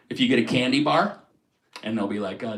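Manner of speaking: usual speaking voice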